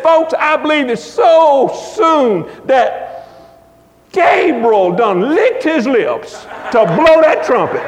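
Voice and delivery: big-time preaching, slow and drawn out; E V Hill. Retold or imitated. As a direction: start high, stretch the words, build to a preacher's climax. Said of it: "He was preaching in a big-time way".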